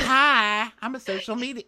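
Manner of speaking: very high-pitched chirpy intro; mock perky contestant. Imitating a contestant introducing herself, exaggerated high perkiness. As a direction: squeal the hello, then carry on high.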